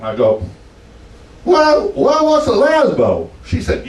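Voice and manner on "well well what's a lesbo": high, drawn-out puzzled voice; mock baffled. To the woman at the bar, high mock bafflement. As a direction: play the clueless country boy.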